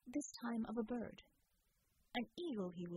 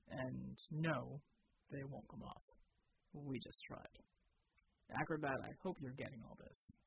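Bulwark's deep voice; 8 semitones lower than usual. Acted. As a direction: state it flatly, deep and unhurried. Bulwark continues to squad.

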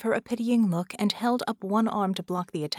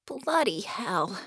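dismay; 2 semitones higher, slower than usual. A narrator's reading. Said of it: "Bella said in dismay".

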